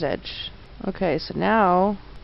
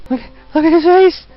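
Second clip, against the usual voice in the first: much higher, louder gleeful yelling; excited shouting; 12 semitones higher, 9 dB louder than usual. Real, not acted. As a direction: shout with delight at the silly face. Laughing at funny game character.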